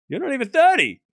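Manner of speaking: laughing through a high, loud protest. Retold or imitated. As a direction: laugh the words out in disbelief. Laughing at player calling himself old.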